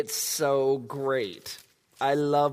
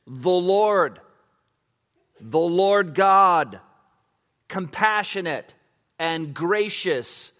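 raised, slow and solemn reading; scripture reading; 6 semitones higher, 4 dB louder, slower than usual. Acted. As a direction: proclaim the verse slowly. Reads scripture aloud fervently.

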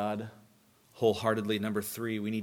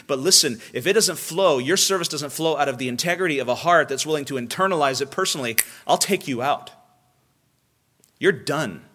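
loud, climbing preacher's voice; full-force preaching; 5 semitones higher, 6 dB louder than usual. Acted. Preacher warning his congregation in a loud, driving sermon voice. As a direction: thunder it from the pulpit.

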